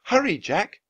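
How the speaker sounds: high, urgent call; crying out. A narrator's reading. Annie hurries Jack to the treehouse, crying out. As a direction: urge him on with a lifted voice. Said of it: "cried Annie".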